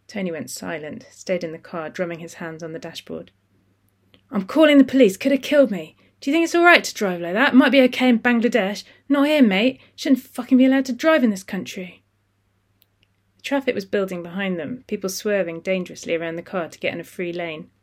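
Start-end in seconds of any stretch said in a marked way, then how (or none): 4.3-12.0 loud, high ranting shout; furious van driver. Acted. Van driver yelling at Khaled after the crash, furious. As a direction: rage at him like a wronged bully.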